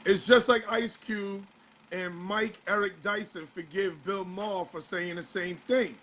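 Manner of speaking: ordinary talk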